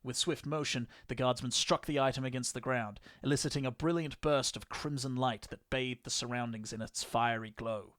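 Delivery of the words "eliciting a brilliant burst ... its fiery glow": usual speaking voice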